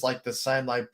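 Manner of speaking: normal talking voice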